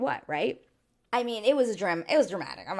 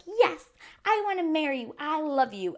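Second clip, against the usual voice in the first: high, eager mock declaration of love, a little louder; hammed-up eager bride blurting out her yes; mock gushing bride; 9 semitones higher, 4 dB louder than usual. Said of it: "instead of me being like".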